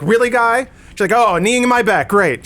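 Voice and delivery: mock sarcastic complaint. Mocking the woman complaining at the passenger behind, sarcastic. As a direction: imitate her huge production. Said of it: "making a huge production".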